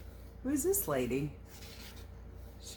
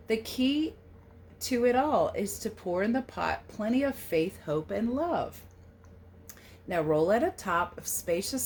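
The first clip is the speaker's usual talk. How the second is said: storybook read-aloud; 3 semitones higher, 5 dB louder than usual. A narrator's reading. Reading the American Pie book aloud to children, storybook lilt. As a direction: read the verse a little louder, with a gentle lilt. Reads the picture book text.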